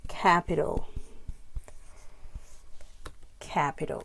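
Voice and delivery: CAPital, weight on CAP; stress on first syllable. Shown as a demonstration. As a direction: lean on the start of Capital. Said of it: "the stress syllable".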